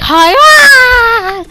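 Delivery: high loud scream; high yelp of a falling hero. Retold or imitated. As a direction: scream as he gets hit. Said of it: "And he's like".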